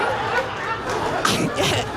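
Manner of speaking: high breathy cackle. Real, not acted. Comedian laughing at his own story, high.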